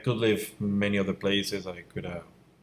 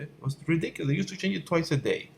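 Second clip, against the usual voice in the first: exasperated disbelief; 8 semitones higher than usual. Real, not acted. Artist ranting about constantly changing software, incredulous. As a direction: pitch way up, incredulous.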